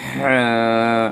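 হ্যাঁ pushed from mid-throat with pressure; Arabic ح from the throat; throaty ha sound. Shown as a demonstration. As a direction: breathe হ্যাঁ out hard from the throat. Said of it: "হলকের মধ্যখান থেকে pressure দিয়ে".

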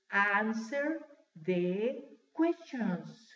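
very slow, pauses between words. Shown as a demonstration. Teacher asking class to repeat, word by word. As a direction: model it slowly for repetition. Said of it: "Repítanlo".

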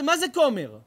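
ordinary talk, nothing marked

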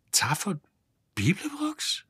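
a weak, breathless whisper rising at the end; faint whisper. Acted. Ford stunned hears who stole the ship, faint whisper. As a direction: barely voice the name, stunned. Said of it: "flüsterte er matt".

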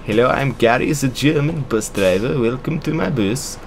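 badly faked German accent, drifting Swedish. Gary welcomes passengers to his bus, bad German accent. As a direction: attempt a comic German accent. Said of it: "Gary should have a German accent".

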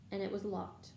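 ordinary delivery, nothing marked

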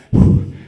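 loud blown-out whew of relief; relieved sigh. Retold or imitated. Locked in the van, loud relieved whew. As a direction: let the breath rush out, safe at last. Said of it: "And I'm like".

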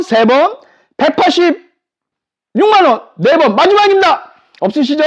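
auctioneer. Acted. Final auction countdown call.

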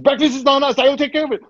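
high-pitched, frantic placating; imitating a panicked manager, voice shot up high; flustered manager. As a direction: scramble to appease the guest.

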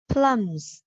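Plums with lips popping the P, final S sounded; Plums, both ends articulated for a learner; clear first and last sounds. Shown as a demonstration. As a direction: press the lips, release a light puff on Plums. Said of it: "lưu ý cả âm đầu và âm cuối".

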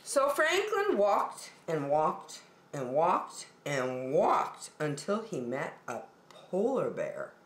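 ordinary delivery, nothing marked

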